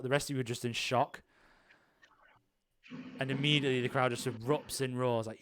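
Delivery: usual speaking voice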